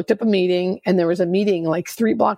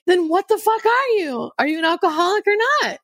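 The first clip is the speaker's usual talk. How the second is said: tough sponsor; 11 semitones higher than usual. Retold or imitated. Hard-ass sponsor confronting her.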